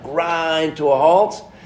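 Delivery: slow Southern drawl; Southern accent. Retold or imitated. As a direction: drag it out like him. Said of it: "in his great Southern accent".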